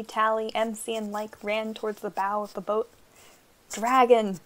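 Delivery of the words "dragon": urgent warning. A narrator's reading. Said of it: "said Pana, pointing at the winged lizard".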